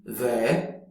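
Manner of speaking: there with American vowel. Shown as a demonstration. Teacher showing American there, American accent. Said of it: "In American".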